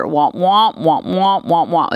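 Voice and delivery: droning, wordless womp-womp noises; Charlie Brown's teacher. Retold or imitated. Teacher as kids hear her, womping drone. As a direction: imitate a muffled cartoon grown-up droning on. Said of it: "being Charlie Brown's teacher".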